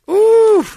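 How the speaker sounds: squealing ooh; high flirty ooh. Acted.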